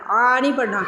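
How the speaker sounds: ordinary talk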